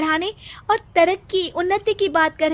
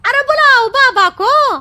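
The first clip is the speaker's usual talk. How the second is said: louder, higher shout at the chief; angry shout; 6 semitones higher, 6 dB louder than usual. Acted. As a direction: yell to fetch the holy man. Villager demands the holy man.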